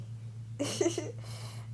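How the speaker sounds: breathy, high-pitched chuckle; real giggly, airy laugh. Real, not acted. Laughs at own unspoken bias.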